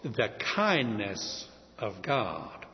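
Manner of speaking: "kindness" slowed, dropped low, with the "n" held; quiet, slow weight on "kindness"; stress on kindness. Shown as a demonstration. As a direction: linger on "kindness" like the key word. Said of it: "remember, the main lesson".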